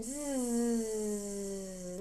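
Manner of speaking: a steady zzz buzz; gentle sustained zzz. Shown as a demonstration. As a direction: hold a long z sound. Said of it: "on a z sound".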